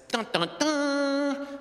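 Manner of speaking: high, slow, loud mock suspense sting; dramatic dun dun dun. Imitating the family's cliffhanger sting on the call. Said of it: "everyone in the call makes this Macaulay Culkin surprise face".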